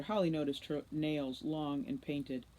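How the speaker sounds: usual speaking voice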